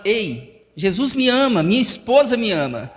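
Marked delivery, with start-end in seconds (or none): none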